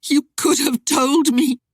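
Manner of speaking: crying out. Acted. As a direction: cry it at him, hurt. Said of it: "she cried".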